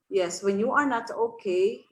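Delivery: ordinary talk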